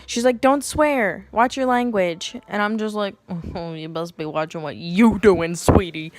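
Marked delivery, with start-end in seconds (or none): 0.4-2.4 louder, higher telling-off; mock scolding yell. Nurse yelling at a labouring patient for swearing, mock scolding. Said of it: "she yells at me again". 3.5-6.1 louder, sarcastic comeback; mock sassy retort. Labouring woman talking back to the nurse, mock sassy.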